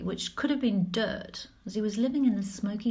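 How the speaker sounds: plain reading voice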